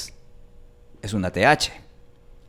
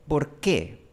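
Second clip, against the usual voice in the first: ordinary talk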